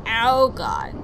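sudden gasped oh God; real startle; startled. Real, not acted. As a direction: caught off guard by a near fall. Sudden near death scare.

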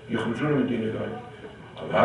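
ordinary delivery

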